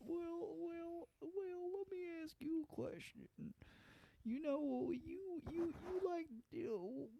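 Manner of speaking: condescending high, soft drawl of a TV host; Doctor Phil. Acted. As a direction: patronize slowly in a high, hushed mock voice. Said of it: "He's all condescending".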